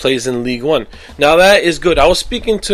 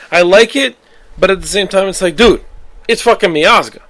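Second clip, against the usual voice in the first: higher-pitched, impassioned pleading; worked-up fan rant; 6 semitones higher, 3 dB louder than usual. Real, not acted. Fan wanting to see Miazga starting at Chelsea, worked-up rant. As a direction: get carried away arguing for your favorite player.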